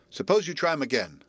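ordinary delivery

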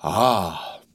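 drawn-out sigh of satisfaction; slow exhale after a deep drink; long-drawn ah. A narrator's reading. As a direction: let out a long, low, contented "ah". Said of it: "gave vent to a long-drawn".

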